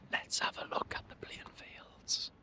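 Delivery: slow soft ASMR whisper. Pretend. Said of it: "an A S M R video".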